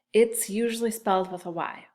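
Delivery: ordinary delivery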